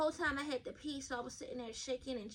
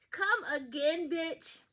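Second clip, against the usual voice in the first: sassy disbelief; 4 semitones higher, 6 dB louder, much slower than usual. Real, not acted.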